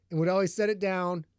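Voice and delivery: ordinary delivery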